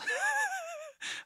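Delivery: a sudden high-pitched giggle; he breaks into a squeaky laugh at himself. Real, not acted. Vlogger cracks himself up over chips, high squeaky laugh.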